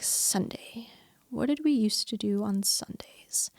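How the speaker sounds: breathy whisper, rising on Sunday; wondering whisper. Retold or imitated. Realizing it's Sunday, asking herself quietly, whispered. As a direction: whisper it as if just remembering. Remembering family Sundays, inner thought.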